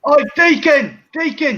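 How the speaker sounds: loud, high East End call; East London accent. Acted. Host mimics soap characters for friends, East London accent. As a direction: holler it in mock EastEnders London. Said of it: "you're from East London".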